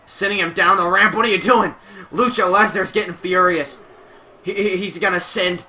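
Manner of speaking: ordinary talk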